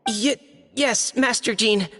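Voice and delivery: stumbling, timid reply; nervous stammer. Acted. Young knight answers the acting Grand Master, nervous. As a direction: trip on the first word, anxious to obey. Shy knight told to begin.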